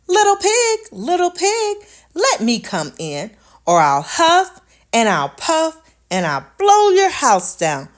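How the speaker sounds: high, loud calling wolf; big bad wolf. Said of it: "called the wolf".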